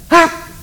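sharp high exasperated "Ah!"; a mock-frustrated yelp, high and loud; exasperated cry. Nicodemus fed up with Jesus, high exasperated yelp.

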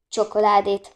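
usual speaking voice

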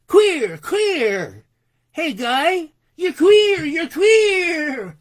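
loud, high taunting shout; jeering yell. Acted. Thuggish fellows jeer at a passing fiddler, taunting yell. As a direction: yell it like louts heckling in the street. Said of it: "loutish fellows yelled".

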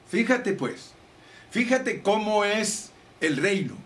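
usual speaking voice

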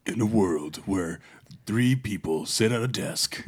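slow, weighty trailer announcer; slow-paced mock-epic narration; movie trailer voice. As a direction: draw it out like a film trailer. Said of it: "your movie voice".

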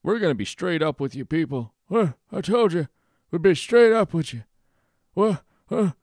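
high, loud mock impression; shrill hammed-up politician voice; mocking Biden. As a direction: squeal it out as a jeering send-up. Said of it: "Biden saying".

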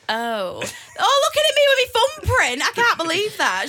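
hammed-up offended gasp; mock offended shock. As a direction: act scandalised at the thumbprint phone.

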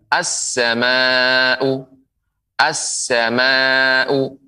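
very slow "as-sama'u", held s and long a; merged article. Shown as a demonstration. Quran teacher models the letter sin, merged article. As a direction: stretch "As-sama'u" on a level note. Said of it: "lamnya dilebur menjadi".

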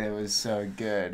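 slow, tired, hungover voice. Real, not acted. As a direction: drag it out, worn out and hungover. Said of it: "such low energy".

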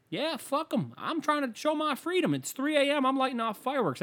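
squeaky mocking voice of a fireworks idiot; mock dumb patriot. Comedian lampooning fireworks fans in a high dumb voice.

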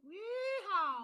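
loud, high whooping yeehaw; cowboy yeehaw. Pretend. Cowboy cheer after Sandy Cheeks.

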